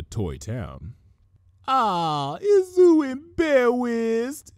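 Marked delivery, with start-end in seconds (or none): 1.7-4.5 put-on high, slow cooing baby talk. A narrator's reading. Pinky teasing Twilight while squishing her cheeks, high, slow baby talk. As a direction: coo at her like a toddler. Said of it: "Pinky asked, smushing Twilight's cheeks".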